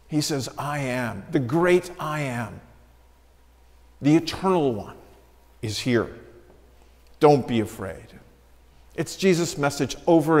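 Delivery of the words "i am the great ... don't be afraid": gentle reassurance. Acted. Jesus voiced gently in sermon.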